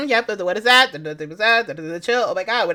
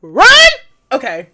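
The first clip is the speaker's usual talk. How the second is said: usual speaking voice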